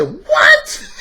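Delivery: outraged husband yelping in disbelief; a husband hearing his wife say king; stunned husband. Retold or imitated. Husband shocked, shouting on phone.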